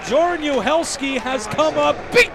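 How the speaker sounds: loud, high shouting call of back-to-back saves; full-voiced hockey call; excited play-by-play. Real, not acted. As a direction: roar the double save. Second save call.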